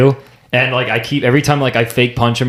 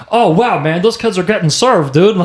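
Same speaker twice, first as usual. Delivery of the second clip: high-pitched mock amazement; high, goofy bystander voice; cartoon onlookers; 7 semitones higher than usual. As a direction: imitate awed South Park townsfolk, pitched up. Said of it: "all the people in the back are like".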